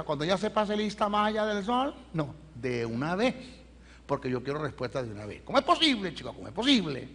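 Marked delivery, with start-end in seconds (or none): 5.4-6.3 incredulous exclamation. Real, not acted. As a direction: throw the question out in astonishment. Preacher exclaims in disbelief to audience.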